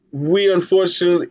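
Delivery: ordinary delivery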